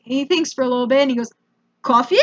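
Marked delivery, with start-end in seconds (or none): 1.8-2.2 put-on questioning, lightly Russian-accented offer; mild Russian pronunciation. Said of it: "mild Russian pronunciation of coffee".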